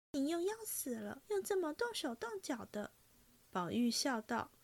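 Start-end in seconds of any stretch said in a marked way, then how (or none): 0.1-2.9 娇嗔地轻骂; coy scolding. A narrator's reading. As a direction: push him off in mock annoyance, half teasing. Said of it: "黛玉忙向后退了几步".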